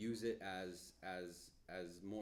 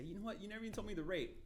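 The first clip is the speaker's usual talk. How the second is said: higher, mock-surprised client voice; higher, teasing impression of a client calling back; 12 semitones higher, 3 dB louder, faster than usual.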